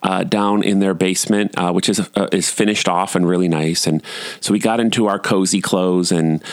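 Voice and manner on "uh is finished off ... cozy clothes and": ordinary delivery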